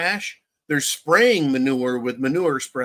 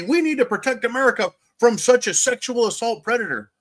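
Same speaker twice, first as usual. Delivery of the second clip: mock-pompous media anchor, higher and sing-song; 7 semitones higher than usual. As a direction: sneer it like a self-righteous newscaster. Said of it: "the media's right there to say".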